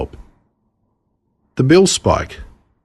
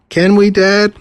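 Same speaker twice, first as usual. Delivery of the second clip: put-on pleading little girl; 12 semitones higher, 4 dB louder, slower than usual. Sister nagging Dad for bird.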